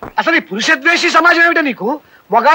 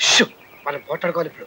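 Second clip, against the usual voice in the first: low, much softer aside to his partner; hushed aside; 11 semitones lower, 9 dB quieter than usual. Acted. Husband quietly plotting a fake quarrel with his wife, hushed. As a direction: drop to a secret murmur before the act. Setting up a staged fight.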